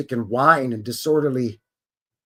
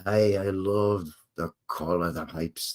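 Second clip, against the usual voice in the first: lower, softer mock Scottish accent; bad Scottish accent; 7 semitones lower, 8 dB quieter than usual. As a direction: try a poor Scottish brogue under your breath. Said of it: "That's the worst Scottish accent".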